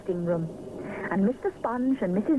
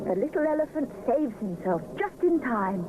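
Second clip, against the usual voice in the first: ordinary delivery, nothing marked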